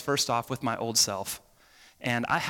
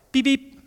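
two short, high little beeps; polite horn beep; 11 semitones higher, 5 dB louder than usual. Retold or imitated. Driver politely nudging the car ahead at green, short polite beep. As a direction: give a quick, nice toot of the horn. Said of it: "I don't mean this nice, polite".

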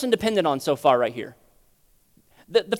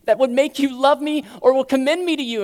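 higher, louder plea of a broken believer; 6 semitones higher, 5 dB louder than usual. Acted. Believer confessing nothing lovable in himself to God, broken plea. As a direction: plead it out, broken before God. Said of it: "become broken and say".

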